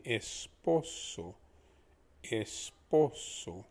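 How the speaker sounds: Esposo drawn out slowly, es-po-so; slow model pronunciation. Shown as a demonstration. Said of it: "Try pronouncing one time after me".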